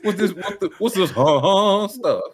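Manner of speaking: high, drawn-out mock bafflement, laughing; Scoob. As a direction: imitate a clueless friend puzzled by it all. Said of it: "twenty minutes of Scoob said".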